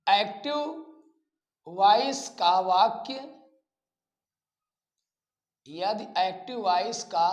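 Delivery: slow, drawn-out dictation with long pauses. Acted. Teacher dictating a grammar rule to students, slow and spaced out. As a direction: say each phrase slowly so students can write it.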